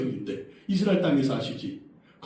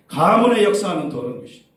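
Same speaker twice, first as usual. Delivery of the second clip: loud, raised rebuke of an evil spirit; forceful rebuke; 4 semitones higher, 9 dB louder than usual. Shown as a demonstration. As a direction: burst out commanding, high and loud. Models rebuking the demon.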